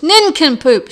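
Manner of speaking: loud, high bellow. A narrator's reading. Midwife bellowing at Beetle, loud and high. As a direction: roar the insult at her. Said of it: "she bellowed".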